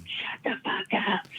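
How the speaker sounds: strained, whispered scream, high and drawn out; mock yell. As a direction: imitate yelling without the volume. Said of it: "she's yelling at this guy".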